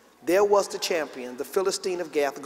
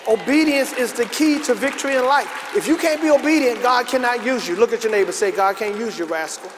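higher, louder, urgent sermon delivery; forceful preaching; 6 semitones higher, 6 dB louder than usual. Real, not acted. Preacher urging young people to be obedient, forceful preaching. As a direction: drive it home to the congregation.

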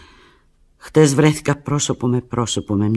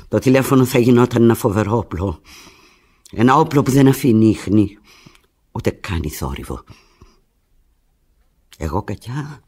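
usual speaking voice

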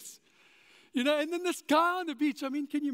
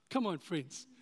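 ordinary delivery

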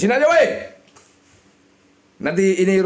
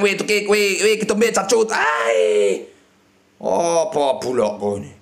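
normal talking voice

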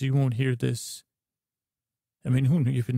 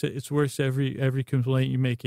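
ordinary talk, nothing marked

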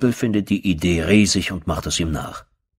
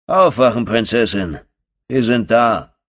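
mocking wake-up call; 4 semitones higher, 4 dB louder than usual. Thug mockingly waking the prisoner on the truck bed, loud. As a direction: call out louder and higher, taunting.

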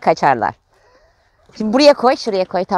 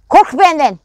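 mock threat; 8 semitones higher, 6 dB louder than usual.

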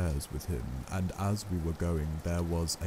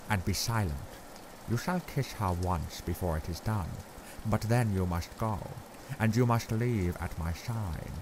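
put-on grave old Dutch professor, accented, measured; Van Helsing's accent. A narrator's reading. Professor gravely telling Arthur Lucy is very bad, accented and grave.

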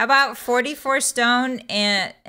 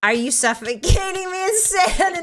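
high, giggly mock outrage; laughing alarm; 8 semitones higher than usual. Real, not acted. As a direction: accuse them through rising laughter. Mock alarm at being buried.

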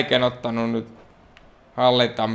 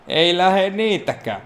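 higher, slow put-on moan of "Ei lähe niitäkään"; a drawn-out mock lament; mock whiny echo; 6 semitones higher than usual. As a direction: repeat it in a sulky funny voice. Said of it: "mutta ei lähe niitäkään".

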